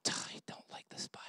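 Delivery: whispered aside, no voice; hushed confession to the crowd. Retold or imitated. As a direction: whisper it like a guilty secret. Joking aside.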